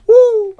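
loud high cheer; whoop. Real, not acted. Cheering for the Charlotte listeners, whooping. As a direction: let out a big whoop.

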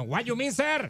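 put-on American accent. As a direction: talk like a gringo cop in American English. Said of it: "Con acento norteño". (acento americano, más agudo y fuerte)

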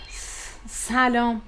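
slow stammered shy hello; trembling voice. Acted. Narrator greeting the handsome guest, shy and stammering. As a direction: stumble over it, flustered. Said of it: "صدایم لرزید و گفتم".

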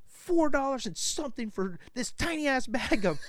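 high, indignant squeal over the price, slowing on the last words; mock outraged wife. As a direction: ham up the wife's outrage at the tiny bag.

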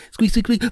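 high squeaky chirps; bird squeaks. Pretend. Imitating birds.

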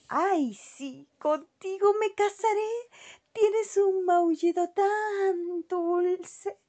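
aguda, alargada y encantada; ratita enamorada. A narrator's reading. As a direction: suspira enamorada como ratón. (ratita al gato, aguda y enamorada)

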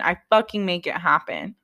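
ordinary delivery

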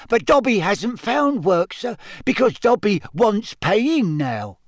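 high squeak, slowing at the end; Dobby. A narrator's reading. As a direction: squeak it out as an earnest little elf. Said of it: "Dobby squeaked".

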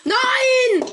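loud dismayed cry; shouting no. Real, not acted. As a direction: yell in defeat. Gets knocked out.

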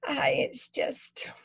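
slow breathy whisper fading out; trailing off tearfully. Acted. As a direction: hold back tears and let it fade. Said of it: "her voice trails off".

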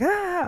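very high mock cry of pain; hammed-up squeal, obviously fake; exaggerated pained wail. [name] answering whether she is okay, fake wailing. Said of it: "this seems fake as fuck".

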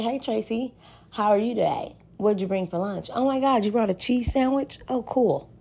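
polite code-switched voice. Retold or imitated. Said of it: "But if I'm asking Tracy, I'm like".

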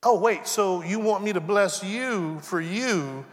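ordinary talk

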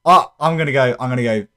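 ordinary talk

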